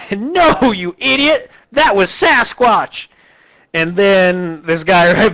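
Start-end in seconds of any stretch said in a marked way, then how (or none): 0.1-3.1 much higher, slow, goofy scolding; know-it-all posse guy. Retold or imitated. Posse guy correcting his neighbour, slow goofy high voice. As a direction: drag out Sasquatch like talking to a fool.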